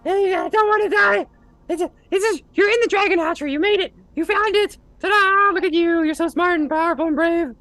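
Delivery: high loud squeaky begging and flattery; grovelling kobold. Acted. Kobold flattering his captor, squeaky and desperate. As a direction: fawn desperately in a tiny high voice. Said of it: "He's like".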